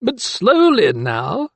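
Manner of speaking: high, slow, knowing smile. A narrator's reading. Magistrate to doctor climbing stairs, knowingly. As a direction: draw it out with a sly smile. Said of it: "lips curling in a knowing smile".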